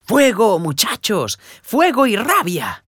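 frantic high shout, slowing at the end. A narrator's reading. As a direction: yell out for help as the hut burns.

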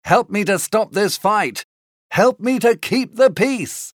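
urgent appeal, each word punched; mock shout. A narrator's reading. Benvolio asking Tybalt for help, restrained urgent shout. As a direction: plead for help without full volume. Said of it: "Benvolio shouted to him".

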